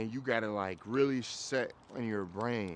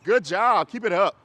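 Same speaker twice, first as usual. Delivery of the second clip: much higher and louder, bright praise; dog praise; 7 semitones higher, 10 dB louder than usual. Real, not acted. Trainer praising the dog for walking right, high excited praise. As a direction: reward the dog with your voice. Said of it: "Means what?".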